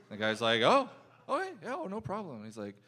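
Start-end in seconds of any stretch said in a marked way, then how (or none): 0.6-2.4 overly nice waiter. Retold or imitated. Waiter to indecisive customer, high mock-polite reassurance. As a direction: imitate someone far too polite to object. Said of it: "the nicest, weirdest guys".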